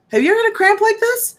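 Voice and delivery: high, fast, disbelieving question; incredulous question. Real, not acted. Woman asking viewers about her awful cramp, high and disbelieving. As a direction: she can't believe how bad it was.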